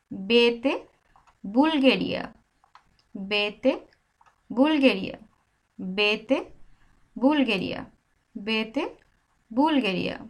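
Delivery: 「বে」 and 「আতে আনা」 chanted as a steady drill; letter drill. Shown as a demonstration. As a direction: chant the letter names in a rhythm for learners.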